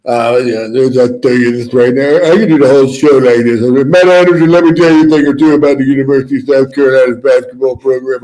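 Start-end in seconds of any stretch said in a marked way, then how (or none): 2.3-8.2 louder coach voice, lisping lightly; rally the team like an old football coach; Lou Holtz impression. Doing Lou Holtz for the radio crowd, louder. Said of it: "Oh, that's good".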